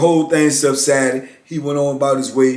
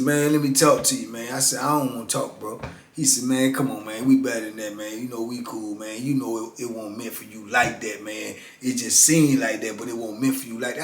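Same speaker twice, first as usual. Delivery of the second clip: hushed apology; 4 semitones lower, 6 dB quieter than usual. Retold or imitated. Key's hushed apology retold.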